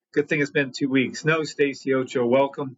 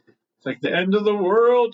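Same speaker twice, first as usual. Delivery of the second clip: higher, mock-dramatic panic about the world ending; mock alarm; 5 semitones higher, 3 dB louder than usual. Trader mocking the panic over the fifteen minute chart, mock alarm. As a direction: ham up the doom a little. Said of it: "It's all about perspective".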